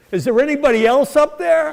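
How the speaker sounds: high, loud desperate yell upward; frantic cry heavenward; panicked shout. Acted. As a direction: blurt it out in a comic panic. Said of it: "and he goes".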